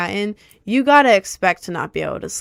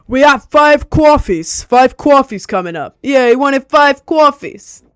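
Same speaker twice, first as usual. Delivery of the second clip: loud, slow, hammed-up New York accent; 6 semitones higher, 9 dB louder than usual. Joking to coworkers at Starbucks, loud exaggerated New York accent. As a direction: holler the order in mock New York. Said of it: "I would just start saying coffee, like, as a bit".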